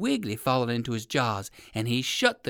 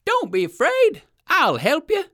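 high, louder kindly reassurance; kind crow voice; 14 semitones higher, 7 dB louder than usual. Acted. Crow calling down to the rabbit, high kind reassurance. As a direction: reassure him brightly from above. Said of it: "exclaimed a kind voice".

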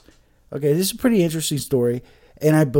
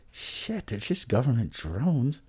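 conspiracy mutter; 9 dB quieter than usual. Real, not acted. Conspiratorial aside.

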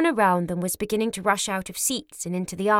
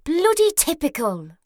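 gasped; 8 semitones higher than usual. Acted.

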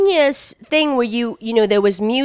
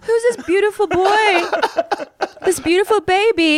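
put-on doting baby talk; 9 semitones higher than usual. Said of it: "'Cause I was".

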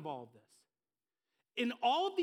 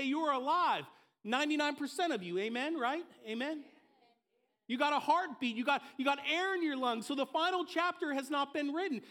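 higher, louder preacher's build; rising preaching; 6 semitones higher, 3 dB louder than usual. Acted. Pastor urging the congregation, rising fervent preaching. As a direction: lift the room with conviction.

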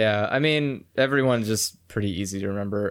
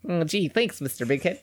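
cartoon voice; 4 semitones higher, 3 dB quieter than usual. Voicing cartoon characters.

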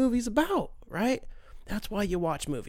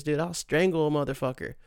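ordinary talk, nothing marked

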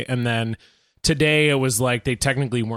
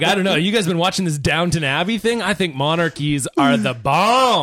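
co-host mimics friend championing monarchies, higher mock-enthusiastic voice; mocking imitation; 4 semitones higher than usual. Co-host teasing friend over monarchy remark, mock-enthusiastic imitation. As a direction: put on an eager fan's voice, drawing out the end. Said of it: "You're like".

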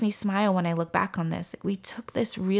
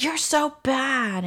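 retold child's yell; 6 semitones higher, much slower than usual. Son to mother in the car, imitated yelling. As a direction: imitate an upset kid's slow whine-yell. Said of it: "with him yelling things".

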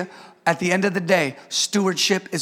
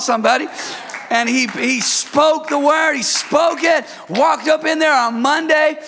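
full-force preaching; 5 semitones higher than usual. Acted. Pastor hypes the church over the brother's exam, shouting. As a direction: preach it out to the congregation, building.

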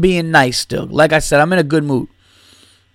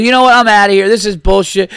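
high, louder indignant heckler; a drunk making a loud exit; huffy heckler storming out; 4 semitones higher, 3 dB louder than usual. Retold or imitated. Heckler storming out of the comedy club, loud and indignant. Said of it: "this guy goes".

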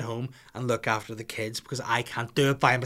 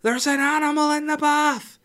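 put-on high little girl's panicked cry, much higher and louder; child yelling; 7 dB louder than usual. Little daughter yells to dad about the bath, high child voice. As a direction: squeal it like a scared toddler. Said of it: "She's like".

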